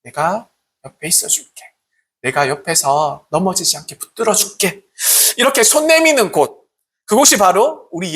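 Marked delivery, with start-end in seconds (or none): 0.0-4.8 softer, tender reassurance; gentle support. Retold or imitated. Preacher voices a friend helping someone who fell, softer and tender. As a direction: hold up a fallen friend softly. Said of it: "왜 이렇게 말하는 것보다".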